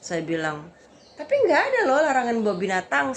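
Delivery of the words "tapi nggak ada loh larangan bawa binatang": high, loud, fast objection; raised-voice retelling of a protest; acted protest. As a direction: argue back against a scolding. Arguing back at angry man.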